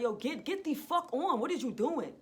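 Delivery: high, heated demand; agitated confrontation. Retold or imitated. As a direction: chase someone off, exasperated. Confronting assistant pulling on handle.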